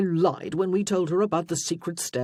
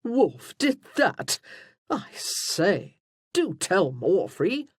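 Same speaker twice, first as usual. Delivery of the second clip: loud, higher and drawn-out glee; delighted, grinning; 5 semitones higher, 4 dB louder, much slower than usual. A narrator's reading. Elaine begs Freya for the story, delighted. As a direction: relish every word with a grin. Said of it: "Elaine laughed heartily, and then continued to grin".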